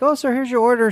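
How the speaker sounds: high-pitched mimicked server; chirpy server. Server handing over a breakfast order, chirpy imitation.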